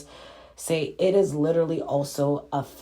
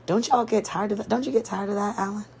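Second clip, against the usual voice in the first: pitched up, incredulous asking; exasperated challenge; 6 semitones higher, faster than usual. Real, not acted. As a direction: challenge them, can't believe it. Rhetorical challenge to the viewers.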